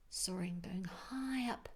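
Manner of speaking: unvoiced whispered commentary; whispered aside. A narrator's reading. Narrator confiding to the listener, in a whisper. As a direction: whisper it like a secret to the child.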